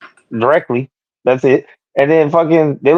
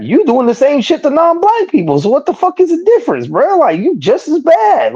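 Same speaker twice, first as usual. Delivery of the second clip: high, incredulous indignation; indignant rant; 12 semitones higher than usual. Real, not acted. He calls out the show's hypocrisy, high and exasperated. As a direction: pitch up in outraged disbelief.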